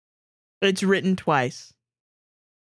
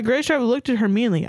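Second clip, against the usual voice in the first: ordinary delivery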